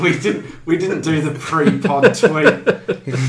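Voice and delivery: slow and loud, laughing as he speaks. Real, not acted. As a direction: laugh through the confession. Laughing admission.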